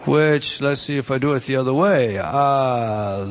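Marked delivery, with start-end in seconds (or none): 2.2-3.2 slow, low, stretched syllables; drawn out. Real, not acted. Teacher sounding out a word while writing it, drawn out. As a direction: draw each syllable out slowly.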